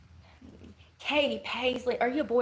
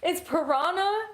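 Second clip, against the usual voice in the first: high, slow, drawn-out It's piranha?; surprised question; 8 semitones higher than usual. Real, not acted. Hearing the chat correct her, surprised and high. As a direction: stretch it out in disbelief, pitched up.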